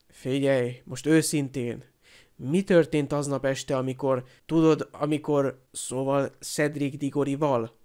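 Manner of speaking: ordinary delivery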